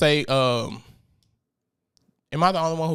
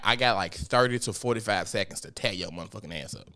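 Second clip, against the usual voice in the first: ordinary talk, nothing marked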